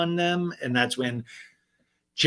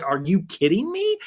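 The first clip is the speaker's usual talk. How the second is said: higher, incredulous challenge; acted indignation; 6 semitones higher than usual. Retold castmate confronting her accuser, indignant disbelief. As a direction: throw it back in disbelief. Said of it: "And Jen's like".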